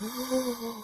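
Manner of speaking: long, quiet gasp of horror; drawn-out comic gasp; shocked gasp. Retold or imitated. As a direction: suck in air in mock shock. Acts out his shocked reaction.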